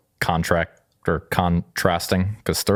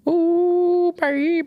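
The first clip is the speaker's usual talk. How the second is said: put-on falsetto ooh baby; much slower than usual.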